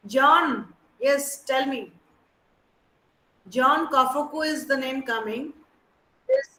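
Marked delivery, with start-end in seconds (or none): none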